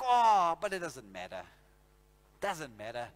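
low, careless "doesn't matter"; shrugging it off. Pretend. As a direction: brush it off lazily. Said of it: "now you're like".

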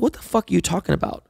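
high-pitched retold disbelief; incredulous. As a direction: act out total bafflement. Retold shocked reaction to news.

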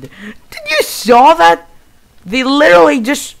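very high, loud disbelief; incredulous shouting. Real, not acted. Player asking viewers about the dragon hit, incredulous. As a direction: squawk it out, astonished by the attack.

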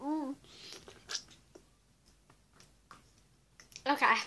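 talking around a lemon; muffled by a lemon in mouth; mouth full of lemon. Real, not acted. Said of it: "stuck a lemon in my mouth".

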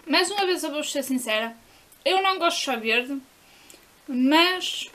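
food in mouth; slightly muffled chewing speech; talking while chewing. Real, not acted. Seen 0: eats before the words; jaw and pressed lips show chewing early on.

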